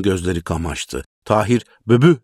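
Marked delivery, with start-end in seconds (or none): 1.9-2.2 loud, high excited call; shouted name. A narrator's reading. Tahir bursting in on the sick woman, excited shout. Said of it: "diye bağırdı".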